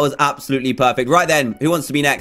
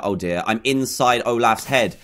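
normal talking voice

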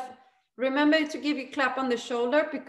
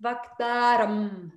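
vaktaram slow, with more sound; emphasize vaktaram; 3 semitones lower, much slower than usual. Shown as a demonstration. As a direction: give vaktaram a little more sound, drawn out. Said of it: "So emphasize the".

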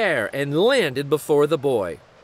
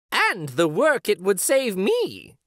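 grinning wife; 7 semitones higher than usual. Acted. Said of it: "grinning back".

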